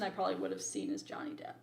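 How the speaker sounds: quick whispered confession; whispered aside. Real, not acted. Reviewer confiding which star stood out, quick whisper. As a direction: let it slip out as a quick whisper.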